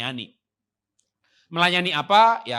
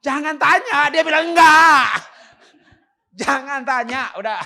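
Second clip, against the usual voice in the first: much louder, higher warning; emphatic shout; 12 semitones higher, 17 dB louder, slower than usual. Real, not acted. Pastor warning husbands not to ask, shouted loud.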